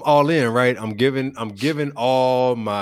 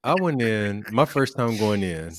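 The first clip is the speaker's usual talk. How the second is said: ordinary delivery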